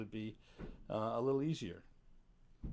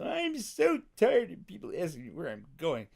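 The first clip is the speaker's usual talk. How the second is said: louder, higher snippy complaint; 8 semitones higher, 7 dB louder than usual. Retold or imitated. Retelling what the old friend said, snippy. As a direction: snap back, fed up with the question. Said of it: "got a little snippy with me and said".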